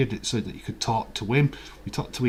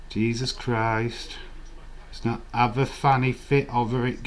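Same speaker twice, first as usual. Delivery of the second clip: usual speaking voice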